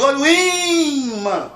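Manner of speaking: high, loud commentator cry, I of "imã" held long; Galvão Bueno. Acted. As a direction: call it out like a match commentator, stretching the first vowel. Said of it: "lembrar da narração do Galvão Bueno". (narração esportiva, "imã" com o I esticado)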